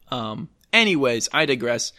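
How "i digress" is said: ordinary talk, nothing marked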